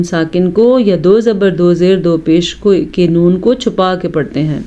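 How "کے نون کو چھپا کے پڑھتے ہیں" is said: ordinary talk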